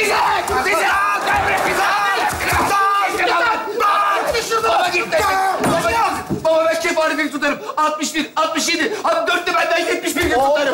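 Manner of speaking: high, frantic begging; desperate yelling. Acted. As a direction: scream as if the food is being taken away. Starving son screams for the food.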